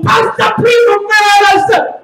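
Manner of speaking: high, drawn-out showy holler; big theatrical shout, held long; mock-Italian bellow. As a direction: belt it out like a hammy Italian. Showing off his one Italian word.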